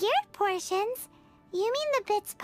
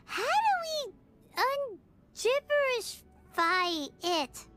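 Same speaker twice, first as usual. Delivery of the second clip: slow, word by word; halting, puzzled; 4 semitones higher, slower than usual. Acted. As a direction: sound it out slowly with pauses. Puzzling over how to decode gibberish.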